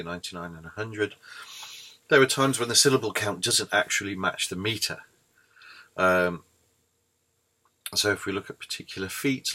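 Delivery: usual speaking voice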